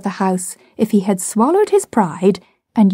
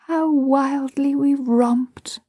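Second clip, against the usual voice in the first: fond memory; 6 semitones higher, much slower than usual. A narrator's reading. Mother fondly recalling.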